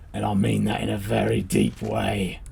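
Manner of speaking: mock deep sincerity. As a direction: drop right down and slow it for a cheesy deep promise. Mock gushing at the professor.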